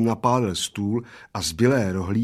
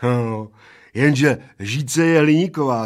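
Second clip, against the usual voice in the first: smug, triumphant retort a bit louder; 4 semitones higher, 6 dB louder, slower than usual. A narrator's reading. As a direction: score the winning point. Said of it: "triumfoval Plešatec". (vítězoslavně)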